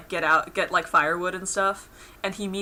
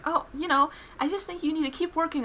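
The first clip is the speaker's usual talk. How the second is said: lightly voiced gentle Pim; 10 semitones higher than usual. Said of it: "try to tell him".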